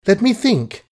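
plead, flustered with joy; fluttering plea. A narrator's reading. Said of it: "she pleaded in a flutter".

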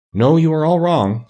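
high, slow, mild correction; meekly. A narrator's reading. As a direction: correct them slowly on a high pitch, lightly meek. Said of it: "said the little man meekly".

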